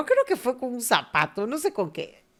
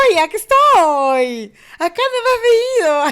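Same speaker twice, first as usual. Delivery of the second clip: mock scolding mother; 11 semitones higher, 13 dB louder than usual. Mother who left her child now acting surprised, loud and higher. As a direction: play the mom popping up and scolding.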